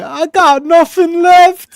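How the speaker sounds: high, slow, tearful wail; EastEnders meme. Retold or imitated. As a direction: wail it like a soap drama. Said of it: "the EastEnders meme".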